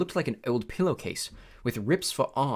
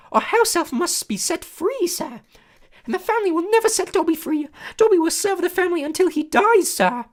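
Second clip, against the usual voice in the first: high, loud squeaky elf voice; a small eager servant creature, awed; squeaky house elf; 8 dB louder than usual. A narrator's reading. House elf greeting Harry, high squeaky and awed. Dobby voice.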